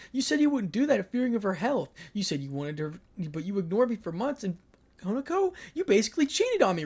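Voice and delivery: put-on high, loud accusing girlfriend voice; jealous girlfriend voice. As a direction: raise the pitch and accuse her lover.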